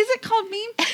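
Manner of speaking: "meme" said high and mocking, like "mee-m". Shown as a demonstration. Host mocking a co-host's French "meme", high and teasing. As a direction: tease the word "meme", up high. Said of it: "What are you".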